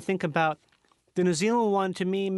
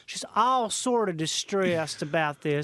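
gruff Southerner. Acted. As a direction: play a put-upon Southern gentleman. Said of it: "like gruff Southerner".

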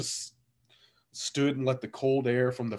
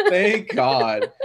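slow, louder, laughing through it; laughing relief; 6 semitones higher, 7 dB louder, much slower than usual. Retold or imitated. Relieved that her mom giggled, laughing thank God.

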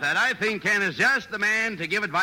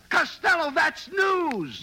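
excited shout; 8 semitones higher, much slower than usual. Acted. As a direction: shout it like a scoop just landed. Abbott excited by gossip.

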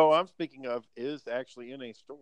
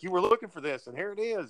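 higher-pitched mock scolding; pitched-up "told you so" telling-off; fed-up teacher; 7 semitones higher than usual. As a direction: imitate a teacher catching a kid out. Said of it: "she finally had enough of him".

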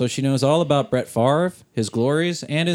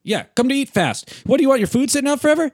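pushy yelling ad; 9 semitones higher, faster than usual. Pretend. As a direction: bark at the customer to eat faster. Mock commercial, yelling at customer.

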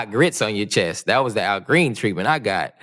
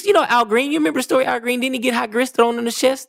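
incredulous high questions; 14 semitones higher, faster than usual. Real, not acted. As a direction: voice jumps way up, disbelieving. Incredulous question about [name].

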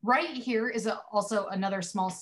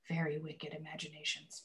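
quiet suggestive whisper; breathy, teasing whisper; hushed innuendo; 3 semitones lower, 11 dB quieter than usual. Real, not acted. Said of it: "I'm sorry. I have self-control".

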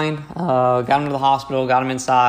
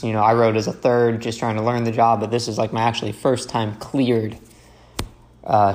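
ordinary talk, nothing marked

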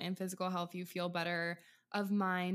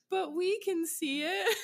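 put-on child whining; 10 semitones higher, 4 dB louder, slower than usual. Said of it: "And I was always like".

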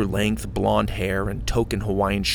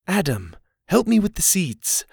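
higher, lightly voiced woman; woman's voice; 9 semitones higher than usual. A narrator's reading. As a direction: voice the woman calling Adam. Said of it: "the woman said".

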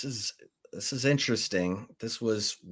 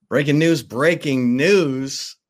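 loud, raised announcer call, stretching the repeat; breaking-news announcement; 4 semitones higher, 5 dB louder than usual. Real, not acted. Host interrupting with Pete Carroll news, loud announcement. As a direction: break in with urgent headline news.

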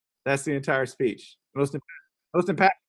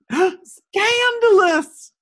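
gasp, then a high drawn-out cry; hammed-up pearl-clutching shock; mock scandalized; 5 dB louder, much slower than usual. Mock shock at coach cussing.

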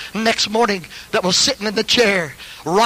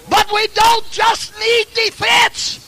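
high, drawn-out pulpit shout; sudden high-pitched holler; preaching shout; 4 dB louder, slower than usual. Real, not acted. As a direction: holler it slowly to the whole church.

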